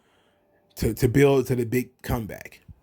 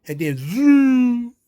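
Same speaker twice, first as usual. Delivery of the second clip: zoom; 8 semitones higher, 6 dB louder, much slower than usual. Retold or imitated. Riddle zooming in on scooter.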